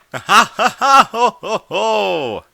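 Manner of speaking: slow, loud, high wailing laugh; a vain, endless triumphant laugh; booming creature laughter. A narrator's reading. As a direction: walrus guffaw from the sky. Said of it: "The wailing of laughter".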